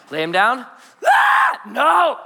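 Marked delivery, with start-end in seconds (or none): 1.0-1.6 very high, loud infant scream; sudden piercing mock baby cry; imitated baby wail. Imitates baby crying when laid down. 1.7-2.2 exasperated dad. Retold or imitated. Exasperated dad reacting to crying baby.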